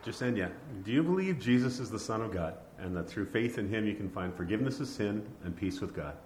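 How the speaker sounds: ordinary talk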